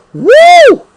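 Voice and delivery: a high, loud whoop of joy; excited whoop. Real, not acted. Worshipper whooping at the preaching, excited whoop. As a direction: let out a celebratory whoop.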